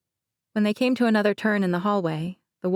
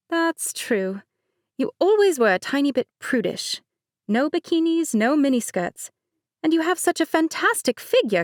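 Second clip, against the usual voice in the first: light higher-pitched bride, laughing it off; Mercedes voice; 7 semitones higher than usual. Acted. Bride Mercedes teasing her cousin, higher light voice. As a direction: play the bride breezily.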